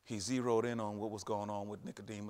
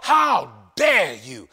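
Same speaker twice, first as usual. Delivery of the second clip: slow, much louder shouted how dare you; shouted taunt; 3 semitones higher, 19 dB louder, much slower than usual. Acted. Preacher shouting the devil's challenge at the congregation. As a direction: roar it from the pulpit. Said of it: "the devil whispers in the ears".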